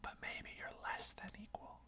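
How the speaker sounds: a sly, breathy whisper of doubt; whispered inner voice. Retold or imitated. Inner voice undermining the listener, whispered. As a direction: hiss it softly like a voice in your head. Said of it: "that little voice is, like, comes in".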